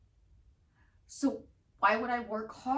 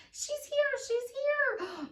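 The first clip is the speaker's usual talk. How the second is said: much higher, sing-song delight; giddy affirmation; 12 semitones higher, slower than usual. Shown as a demonstration. Owner affirming her new puppy is here, giddily. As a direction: squeal it like the puppy just arrived. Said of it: "That's all I have to keep saying".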